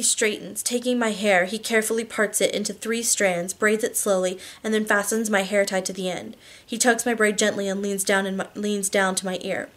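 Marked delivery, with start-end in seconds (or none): none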